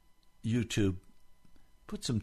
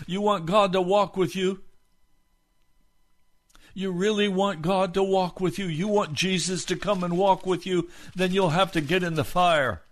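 rising, louder preacher's call, pressing each question; preaching at full force; 7 semitones higher, 6 dB louder than usual. Acted. Pastor challenging the online congregation, loud, high preaching. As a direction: hammer the questions home to the congregation.

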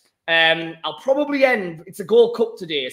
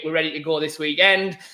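ordinary delivery

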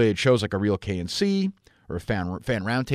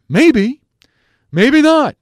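high, loud mock Bradford voice; Bradford impression; 13 dB louder, much slower than usual. Shown as a demonstration. Impersonating Bradford to his listeners, high and loud. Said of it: "I sound like Bradford".

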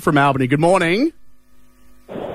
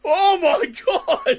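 slow high laughing; 13 semitones higher, much slower than usual. Real, not acted. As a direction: laugh it out slowly. Host laughing after impression.

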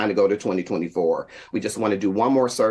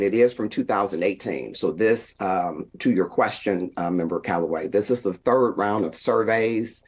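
ordinary talk, nothing marked

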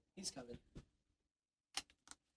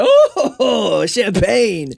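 delighted surprise; 4 semitones higher, much slower than usual. Retold or imitated. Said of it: "walking up like".

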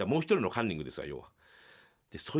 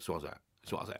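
low, soft grudging apology; sulky apology; 7 semitones lower, 9 dB quieter, much faster than usual. Retold or imitated. Sulking comedian forced to apologize, low grudging mumble. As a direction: mumble it sulkily, not meaning it. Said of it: "ふてくさって".

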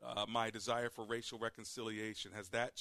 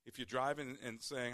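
normal talking voice

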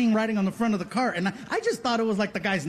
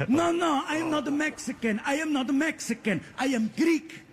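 higher, indignant vendor protesting; offended vendor; 6 semitones higher than usual. Retold or imitated. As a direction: insist on it like a mildly insulted shopkeeper. Offended vendor corrects the customer.

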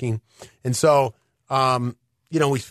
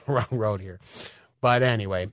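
normal talking voice

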